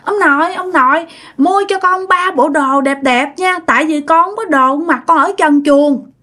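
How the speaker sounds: put-on high, whining spoilt child begging; whiny child. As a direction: nag grandpa like a pouting little kid. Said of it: "làm theo kiểu như con nít mà nhõng nhẽo".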